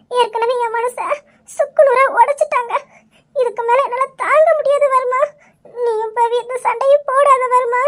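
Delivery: crying wife begging for calm; tearful pleading. Acted. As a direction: plead through sobs, breaking down. Wife tearfully pleads against a fight. (அழுதுகொண்டே கெஞ்சுதல்)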